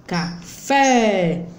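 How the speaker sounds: "café" with a long, strong FÉ; stressed syllable. Shown as a demonstration. Teacher showing the tonic syllable, "café" stressed on FÉ. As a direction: lean hard on the last syllable of "café". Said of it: "mais força, com mais intensidade".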